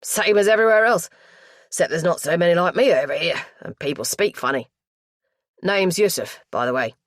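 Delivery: put-on broad, chatty East End accent; thick London accent. A narrator's reading. East End lad introduces himself, thick London accent. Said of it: "He dropped into a stronger accent".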